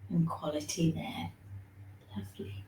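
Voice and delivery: softly whispered close. Real, not acted. Teacher whispering praise as the neck stretch ends. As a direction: drop to a whisper.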